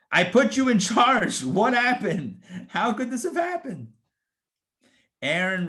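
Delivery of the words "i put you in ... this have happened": high, loud acted reproach; indignant outburst rising high; exasperated scolding. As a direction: call out a brother you trusted, incredulous. Voices Moses calling out his brother.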